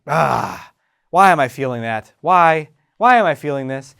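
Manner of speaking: loud, exasperated why; loud pissed-off self-questioning; impatient demanding. Shown as a demonstration. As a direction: snap the question at yourself, fed up. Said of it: "No, it's more like".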